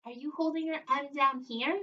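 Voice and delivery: usual speaking voice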